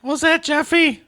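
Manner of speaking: shrill-high granny calling down; high old grandma voice. Pretend. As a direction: call down like a suspicious grandma.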